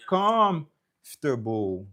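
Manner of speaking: slow comfortable, long COMF, "ter-bowl" dropping away; stressed start, low ending. Shown as a demonstration. Teacher explaining the rest stays low, first-syllable stress. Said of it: "lòt lan tou ba".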